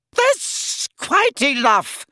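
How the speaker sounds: high, slow, sharp rebuke from a prim seamstress; sharp shopkeeper. A narrator's reading. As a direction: cut them off like a stern shop owner. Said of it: "said Madame Malkin sharply".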